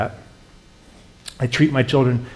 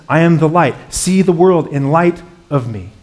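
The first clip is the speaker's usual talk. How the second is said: loud, higher-pitched preaching; impassioned proclaiming; 5 semitones higher, 7 dB louder than usual. Acted. Preacher voicing Jesus's promises to those afraid of losing jobs, impassioned proclaiming. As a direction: drive each promise home with raised voice.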